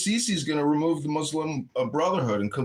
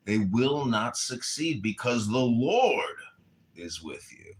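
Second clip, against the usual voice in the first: solemn scripture reading; 7 semitones lower than usual. Acted. Reads scripture.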